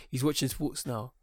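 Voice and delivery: ordinary talk, nothing marked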